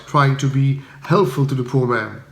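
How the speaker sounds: usual speaking voice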